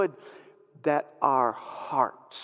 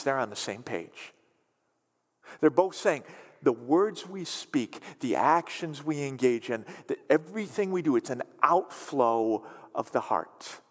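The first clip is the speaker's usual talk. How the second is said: usual speaking voice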